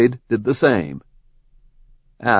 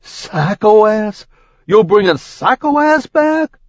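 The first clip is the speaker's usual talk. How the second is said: much higher, louder incredulous sputter; 12 semitones higher, 5 dB louder than usual. Acted. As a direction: choke out the name in horror. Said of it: "Nick sputtered".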